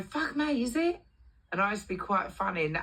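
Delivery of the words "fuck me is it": high-pitched cheeky disbelief; retold sarcastic astonishment; mock surprise. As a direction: feign innocent shock at the news. Her cheeky mock surprise to boss.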